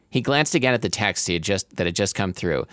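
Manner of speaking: ordinary talk, nothing marked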